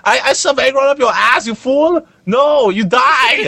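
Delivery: high-pitched mock Chinese accent, hammed up; cliche Chinese accent. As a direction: screech a caricatured Chinese accent, drawing out the last line. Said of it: "he's doing a very cliche Chinese accent".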